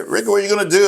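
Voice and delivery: put-on country accent. Texas army buddy asking Rick his plans, in an imitated country accent. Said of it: "he said in his country accent".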